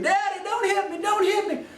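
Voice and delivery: very high mock scream of panic; screaming boy. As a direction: imitate a terrified kid screaming for mercy. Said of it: "And he's screaming".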